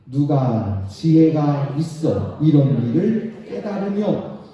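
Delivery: loud, slow unison scripture reading; slow congregational reading. Real, not acted. Pastor leading congregation through Hosea, slow loud reading. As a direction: read it out slowly and loudly with the church. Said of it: "같이 한번 읽고".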